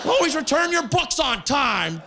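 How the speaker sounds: high, slow hammed-up hero voice; superhero. Comic pretends to be a library superhero, high and slow. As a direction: proclaim it like a dorky hero.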